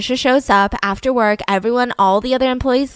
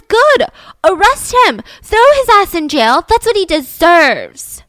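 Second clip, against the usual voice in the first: loud, high, drawn-out acted outrage; furious daughter; 7 semitones higher, 6 dB louder than usual. Daughter to police about arresting her father, furious and defiant. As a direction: spit out each demand loudly and slowly, pitched high.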